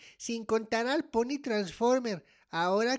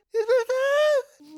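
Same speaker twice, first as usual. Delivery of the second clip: very high, loud, drawn-out wail; horrified wail; 12 semitones higher, 6 dB louder, much slower than usual. Acted. Child realizes dad means it, horrified wail. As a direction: stretch the question into a slow, horrified cry.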